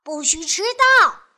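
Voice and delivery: loud, high bossy yell; scolding shout. Acted. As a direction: shout it down the phone like an order. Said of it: "她在电话里喊道".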